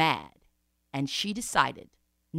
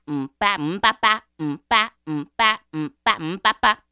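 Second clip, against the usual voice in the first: loud percussive "mm-ba" chant; rhythmic refrain; 8 dB louder than usual. Acted. Storyteller beating out a drum refrain between lines, chanted. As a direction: beat it out like a drum.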